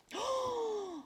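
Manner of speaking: sharp high surprised gasp; big storyteller gasp. A narrator's reading. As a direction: gasp in suspense.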